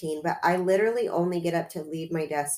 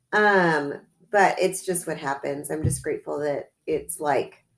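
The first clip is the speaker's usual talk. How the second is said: normal talking voice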